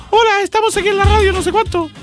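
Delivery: very high, fast mock kid on radio; little kid's voice. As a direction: imitate your squeaky younger self on air. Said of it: "era voz de cabro chico nomás". (voz de cabro chico)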